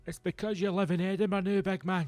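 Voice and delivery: high, needling imitation of critics; mocking accusation. People mocking his move to Edinburgh, high mock jeering. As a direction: mimic people having a go, pitched well up. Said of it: "I know people will say".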